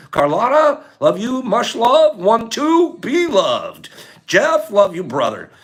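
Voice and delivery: high, loud affectionate shout-outs; calling out farewells. Real, not acted. Streamer signing off to viewers by name, calling out. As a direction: holler each name with love.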